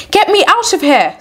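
high, urgent cry for rescue; panicked plea. A narrator's reading. Eccles begging the guides to leave, panicked. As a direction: beg to be taken away.

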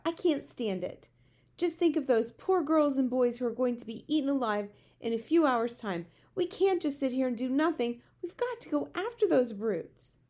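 urgent outburst, pushing for action; crying out. A narrator's reading. Sophie pleads to rescue children, crying out. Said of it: "cried out".

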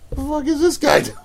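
high, retold bewildered question; baffled onlookers. Men at the urinals to each other, baffled and high. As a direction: pitch it up as puzzled bystanders.

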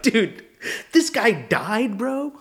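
high, drawn-out disbelief; incredulous shock. Retold or imitated. Reacting to news the comic died, stunned disbelief. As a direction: stretch it out, voice climbing in astonishment.

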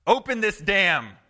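preacher playfully yells at the dam operator; mock command. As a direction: bark the order at the operator for laughs.

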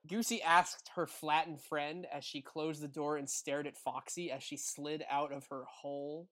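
usual speaking voice

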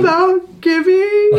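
loud, high mock-weepy wail; hammed-up tearful howl, stretched out; imitating sobbing. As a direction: bawl it slowly as if overcome by tears. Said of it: "sobbing, tears running down my face".

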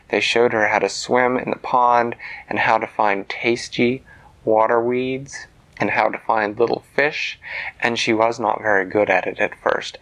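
plain reading voice

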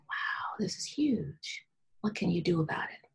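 soft, near-whispered realization; hushed awe. Retold or imitated. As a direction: marvel under your breath, retelling it. Retelling hushed talk to herself.

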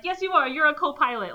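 high-pitched acted contradiction; insistent retort. Group telling a reluctant pilot he can fly, insistent retort. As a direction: push back hard, pitched well up. Acting out others insisting to Gordon.

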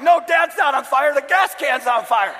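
loud, high, exasperated correction; hammed-up angry dad shouting back; mock angry yell. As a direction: yell it in comic indignation. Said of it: "And now I'm angry".

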